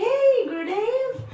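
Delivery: high, loud, playful phone hello; mock phone greeting. Playing along with the guru's pretend phone call, high and bright. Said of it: "pretending I had a phone".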